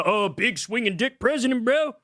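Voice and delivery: mock a Trump fan cheering him on; hammed-up bro voice; mocking fanboy. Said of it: "He just".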